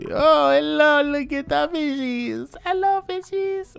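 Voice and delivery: high sing-song coo at the fish; cooing at fishies. Acted. A character greeting the fish, high and cooing.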